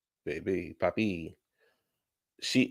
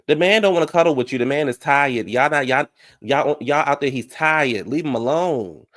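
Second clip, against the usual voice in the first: raised, insistent scolding; exasperated telling-off; 4 semitones higher, 3 dB louder than usual. Acted. Vlogger telling a contestant to leave the tired man alone, exasperated. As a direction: lay into her on his behalf.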